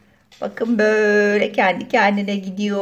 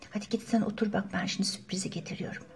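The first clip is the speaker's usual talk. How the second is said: whisper; 4 semitones lower, 13 dB quieter, much faster than usual. Acted. Secret plan before surprise.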